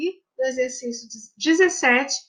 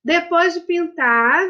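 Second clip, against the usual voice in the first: ordinary talk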